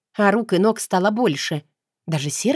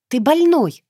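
higher, louder angry cry; furious outburst; 8 semitones higher, 5 dB louder than usual. A narrator's reading. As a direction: hurl it at him in outrage. Captive heroine yells at captor. (гневный выкрик, выше и громче)

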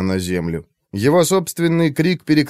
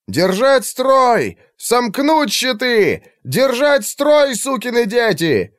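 much higher, slow barked battle commands; gruff barked parade orders; 13 semitones higher, slower than usual. A narrator's reading. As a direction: bark orders down the shield line.

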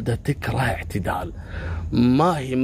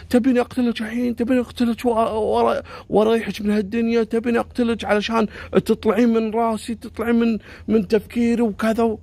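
threatening outburst; 8 semitones higher than usual. Retold or imitated. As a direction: threaten her in a frenzy. Stalker threatening with knife.

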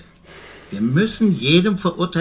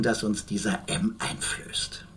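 hushed whisper; 4 semitones lower, 11 dB quieter, slower than usual. Acted. Lawyer speaking of the film M.

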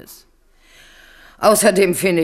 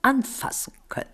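a whispered lure; whispered tempting; 8 semitones higher, 7 dB quieter than usual. Acted. Tempting the queen with gold, whispered. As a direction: breathe it like a secret.

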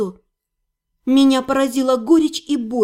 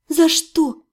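plain reading voice

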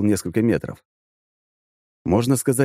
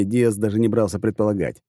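usual speaking voice

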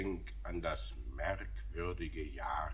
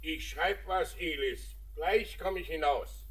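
higher, louder call from indoors; raised shouted reply; calling back; 9 semitones higher, 7 dB louder than usual. Acted. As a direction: answer across the house. Father calls back from indoors.

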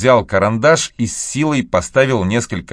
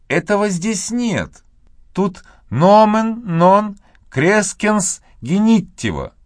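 put-on high, know-it-all little girl; correcting girl; 12 semitones higher, slower than usual. A narrator's reading. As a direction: correct him like a clever girl. Maggie correcting Tom.

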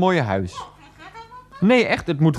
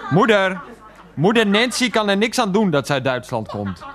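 raised-pitch exasperated protest; indignant; 6 semitones higher than usual. Acted. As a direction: argue back at your mother, pitched up. Quarrels with mother defending Nancy.

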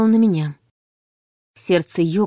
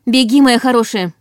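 urgent shout; 4 semitones higher, 6 dB louder than usual. A narrator's reading. Urging child to flee.

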